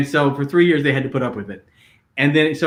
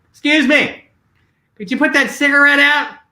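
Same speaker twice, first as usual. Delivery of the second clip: higher and louder, stiffly polite; indignant request; 11 semitones higher, 6 dB louder than usual. Retold or imitated. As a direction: demand he put it out, sharply courteous. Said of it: "and Susan goes".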